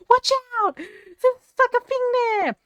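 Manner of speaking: high-pitched panicked gaming yell; retold screaming. Player re-enacting screaming a warning at gaming partner, high-pitched yell. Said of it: "screaming at him".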